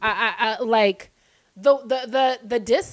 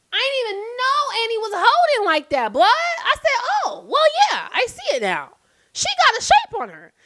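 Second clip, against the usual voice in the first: much higher, louder in disbelief; amazed; 10 semitones higher, 4 dB louder than usual. Real, not acted. Reacting to Annie's shape, much higher in amazement. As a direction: squeal it in shocked delight.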